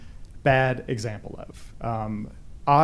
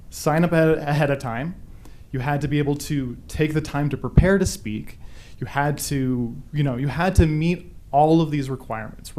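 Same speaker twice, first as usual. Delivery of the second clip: normal talking voice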